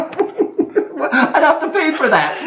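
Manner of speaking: slow, high, laughing words; cracking up at the idea of paying. Real, not acted. Laughing at the dub joke.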